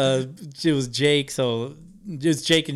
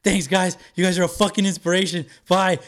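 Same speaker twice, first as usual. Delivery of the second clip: higher, louder gushing thanks; fanboy thanks; 5 semitones higher, 4 dB louder than usual. Retold or imitated. Fan thanking his idols before running off, higher and louder. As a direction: rush it out like a starstruck fan. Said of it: "I'm like".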